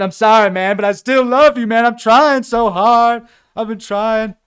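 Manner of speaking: a hammed-up hurt plea; whiny pleading. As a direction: plead like a wounded hippie.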